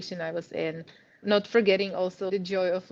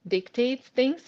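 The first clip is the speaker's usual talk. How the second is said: mock blunt dictating; 4 semitones higher, 4 dB louder, slower than usual. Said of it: "you kinda sound like this person".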